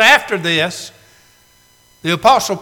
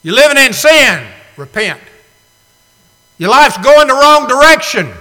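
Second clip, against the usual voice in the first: preaching shout; 7 semitones higher, 8 dB louder than usual. Acted. Preacher calls out the sinner.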